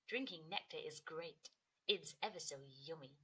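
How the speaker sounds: low soft bee savouring nectar. Acted. Bee continues.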